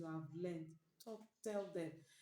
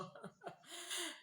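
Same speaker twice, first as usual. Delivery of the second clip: bright burst of high laughter; 13 semitones higher than usual. Real, not acted. Speaker laughing at her own Akure accent, high laugh. Laugh after dialect attempt.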